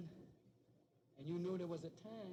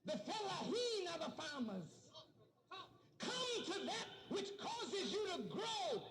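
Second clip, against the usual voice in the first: fiery preaching; 11 semitones higher than usual. Acted. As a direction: preach it over the crowd. Preaching at full force.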